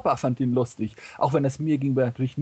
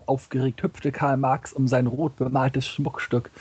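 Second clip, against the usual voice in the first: usual speaking voice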